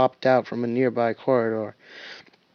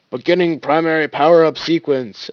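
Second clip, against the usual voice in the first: technician announcing; 3 semitones higher, 7 dB louder than usual. Acted. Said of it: "a technician stated".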